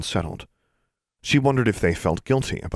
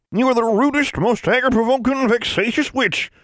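angry; 5 dB louder than usual. A narrator's reading. Granny scolds Alexandra.

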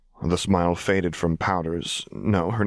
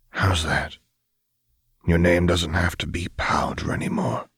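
soft and tender; 2 semitones lower than usual. A narrator's reading. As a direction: comfort her gently. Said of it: "he said softly".

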